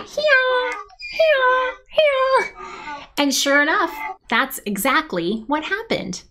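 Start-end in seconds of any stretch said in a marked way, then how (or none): 0.0-3.1 high-pitched donkey hee-haw; donkey braying. Pretend. Storyteller playing the donkey's sound effect, braying high. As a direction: bray like a donkey in short bursts. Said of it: "riding on a donkey".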